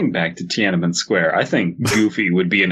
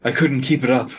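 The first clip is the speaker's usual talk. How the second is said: higher, winded character voice; panting between phrases, laughter creeping in; out of breath from the chase; wheezing; 6 semitones higher than usual. A narrator's reading. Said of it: "he wheezed out".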